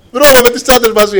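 laughing through the words; amused laughing. Real, not acted. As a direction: break into a laugh while telling it. Preacher laughing at his own anecdote. (γελώντας με την ανάμνηση)

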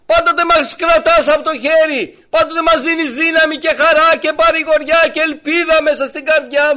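loud, high, impassioned sermon voice; fervent preaching. Acted. Preacher to congregation, voicing Mary and Jesus, loud and fervent. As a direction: preach it out to the whole church.

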